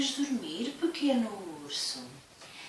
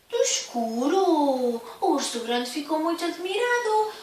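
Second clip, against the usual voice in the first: higher, louder, amazed; surprised; 7 semitones higher, 5 dB louder than usual. Acted. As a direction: sound truly astonished. Big bear amazed. (muito espantado)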